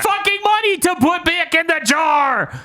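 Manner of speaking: very high, strained mock yell; hammed-up high-pitched frustration at a silly plot; exasperated outburst. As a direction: screech it in comic exasperation.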